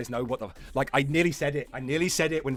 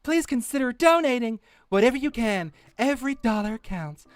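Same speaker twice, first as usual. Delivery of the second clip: sugary, higher charity-appeal read; mock earnest plea read; 11 semitones higher, 6 dB louder, slower than usual. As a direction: ham up the fundraiser's sincerity. Reads donation plea mockingly.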